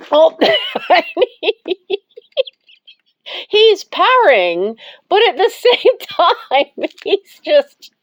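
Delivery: amused, words broken up by laughter; laughing. Real, not acted. Owner watching the cat in a shirt, laughing as she talks.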